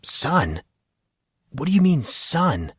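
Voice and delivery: rising, bewildered disbelief on the middle phrase; startled question. Acted. Monson questions the hooded stranger, startled and bewildered. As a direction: caught off guard, demanding an answer.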